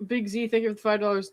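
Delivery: ordinary talk, nothing marked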